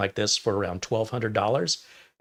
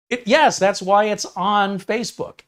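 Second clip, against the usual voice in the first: high, louder, incredulous comeback; mock disbelief at a silly buyer, pitched up and loud; exasperated retort; 10 semitones higher, 5 dB louder than usual. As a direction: answer like the question is absurd. Seller answering obvious buyer question.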